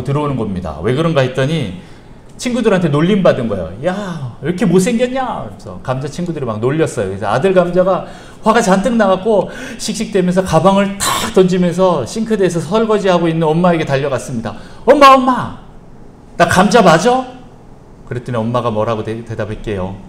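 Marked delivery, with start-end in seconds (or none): 3.9-5.5 put-on teasing kids. Friends teasing the potato. 14.8-17.2 put-on high, loud whiny kid voice; a little potato running to mom in a huff; child crying out to his mother, high and loud; upset child. Son potato runs to mom.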